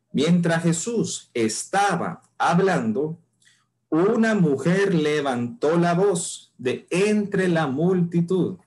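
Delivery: slow, weighty reading of the verse; slow scripture reading. Shown as a demonstration. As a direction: let each word of the passage land. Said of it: "Continúo leyendo".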